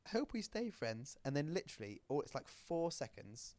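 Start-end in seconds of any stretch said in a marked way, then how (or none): 0.0-1.2 high little-dog voice. Acted. Little dog looking back on making friends, high childlike voice. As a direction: raise it high and slow down sweetly at the end. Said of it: "And so this little dog".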